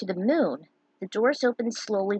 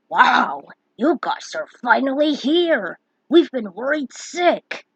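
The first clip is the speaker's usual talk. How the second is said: louder, slower relieved welcome; excited welcome; 4 semitones higher, 7 dB louder, slower than usual. Acted. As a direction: greet them with relief. Greeting the returning heroes.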